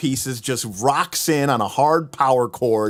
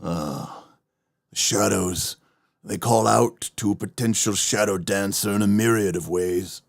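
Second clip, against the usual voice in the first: dark dramatic narration; 4 semitones lower than usual. Acted. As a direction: read it like dark lore. Reads flavour text in dark voice.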